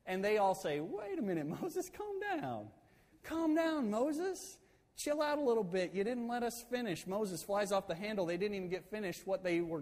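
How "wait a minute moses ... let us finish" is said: high-pitched mock soothing voice; placating. Tribes trying to calm fired-up Moses, high and placating.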